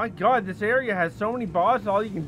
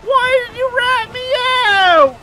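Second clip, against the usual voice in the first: high, loud panicked shouting; panicked yelling; 14 dB louder, much slower than usual. Real, not acted. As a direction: yell in real panic while fleeing. Caught and chased in game.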